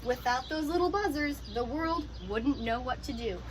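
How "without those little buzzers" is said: ordinary talk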